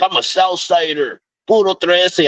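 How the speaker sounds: high mock tough guy; mock gang claim. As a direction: puff up like a wannabe gangster. Voicing the gang-claiming customer.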